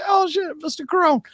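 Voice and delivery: high-pitched squeal of recognition; high, excited mock exclamation; acted surprise. Acting out spotting actor in film.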